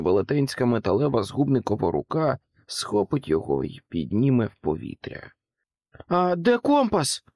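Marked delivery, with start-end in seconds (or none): 6.1-7.2 high, urgent cry while running; shouted question. A narrator's reading. Jack shouting to the pig while fleeing. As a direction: call out over the noise. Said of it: "крикнув він різдвяній свинці".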